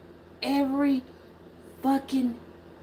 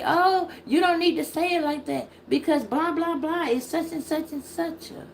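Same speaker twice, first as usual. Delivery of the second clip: mocking the naysayers; 8 semitones higher than usual. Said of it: "then people would say".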